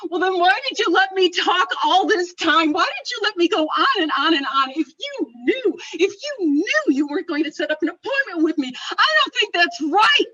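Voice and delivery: high, loud indignant protest; upset outburst. Retold or imitated. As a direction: scold them like you have been wronged. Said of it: "I was upset".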